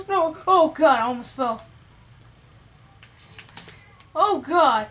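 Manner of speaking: loud alarmed cry; panicked shout. Real, not acted. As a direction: yell in sudden fright. Nearly falls off the stage.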